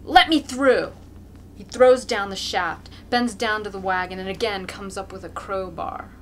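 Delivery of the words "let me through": loud, higher demand; shout. A narrator's reading. Mokolka demanding space, shouting. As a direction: push through barking. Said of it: "Mokolka shouts".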